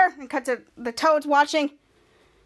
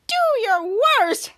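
high, slow, menacing drawl in a put-on villain voice; hammed villain taunt; 7 semitones higher, 4 dB louder, much slower than usual. Captured hero taunts the villain in a slow, put-on villain voice. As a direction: stretch it out like a gloating cartoon villain.

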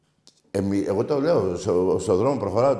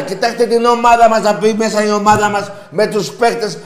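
high, forceful raised voice; loud impassioned rant; 9 semitones higher, 7 dB louder than usual. Real, not acted. Host cuts off a doubting Olympiakos fan, loud high rant. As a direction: override the caller at full volume.

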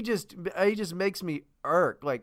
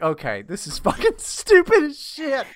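louder, higher, cracking into laughter mid-line; exasperated giggling aside; laughing disbelief; 6 semitones higher, 5 dB louder than usual. Retold or imitated. Retelling his reaction to the news, laughing in disbelief. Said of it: "I'm like".

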